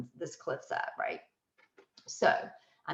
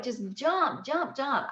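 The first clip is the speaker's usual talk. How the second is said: lightly imitated encouragement, slightly raised; cheering on; 5 semitones higher, slower than usual. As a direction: coax a nervous friend along, mildly peppy. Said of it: "cheering me on".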